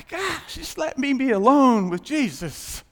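loud, high, fed-up plea; exasperated plea. Retold or imitated. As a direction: beg to be left alone, worn out. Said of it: "it was like".

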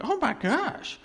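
high, loud, gushing with joy; excited praise. Pretend. Preacher showing the church how to get excited. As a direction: burst out in thrilled wonder. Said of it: "we really could get excited about it".

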